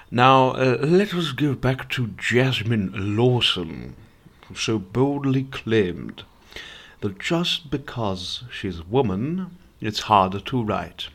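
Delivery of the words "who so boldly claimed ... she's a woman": normal talking voice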